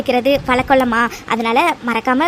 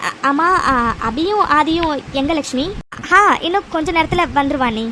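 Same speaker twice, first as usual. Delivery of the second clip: ordinary talk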